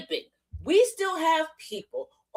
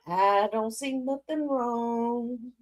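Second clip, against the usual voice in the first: slow, soft, feigned innocence; mock innocent; 6 dB quieter, much slower than usual. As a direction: play dumb. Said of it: "We're over here like".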